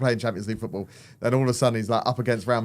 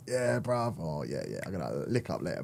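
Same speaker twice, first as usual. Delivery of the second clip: low, very soft mumbled slang; casual mates' slang; 8 semitones lower, 12 dB quieter than usual. Retold or imitated. Imitating a footballer with his mates before interview, low casual slang. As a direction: drop it right down, lads chatting among themselves. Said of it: "Code switching".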